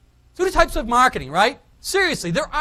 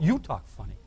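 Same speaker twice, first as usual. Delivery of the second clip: lower, softer playful jab; low teasing comeback; 7 semitones lower, 8 dB quieter than usual. Acted. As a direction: toss it back at them, quietly teasing. Joking comeback to audience.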